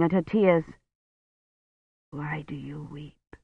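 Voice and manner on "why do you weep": slow, much softer, thick-tongued words; blurred dying voice. A narrator's reading. Dying Roche speaks to Kivrin, slow, soft and blurred. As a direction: speak through a swollen tongue.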